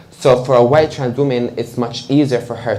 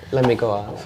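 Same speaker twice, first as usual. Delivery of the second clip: laughing quote; 4 dB quieter than usual. Retold or imitated. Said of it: "so I was like".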